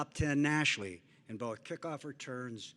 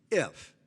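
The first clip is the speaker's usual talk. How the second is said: if punched out louder on its own; emphasis on if; 2 semitones higher, 6 dB louder than usual. Real, not acted. Speaker urging parents at the banquet, stressing if. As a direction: hit the word if hard and pause after it. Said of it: "and I emphasize the word if".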